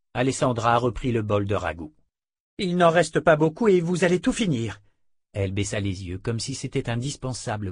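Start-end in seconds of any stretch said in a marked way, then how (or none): 2.6-4.8 higher, insistent order; stern dark sister. Acted. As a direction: press her to obey, pitched up. Alessandra orders abbess to finish.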